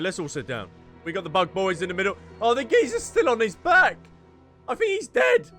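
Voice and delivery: usual speaking voice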